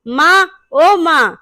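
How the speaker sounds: slow, loud call across the house for mother. A narrator's reading. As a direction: call out to mom, stretching it. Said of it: "जोर से चिल्लाता है". (ज़ोर से माँ को पुकारना)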